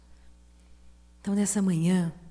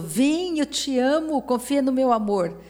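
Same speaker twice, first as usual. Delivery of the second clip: higher, tender calling voice; voicing God inviting; 5 semitones higher than usual. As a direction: call her up to you lovingly. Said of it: "Deus falou". (Deus chamando com carinho, voz mais aguda)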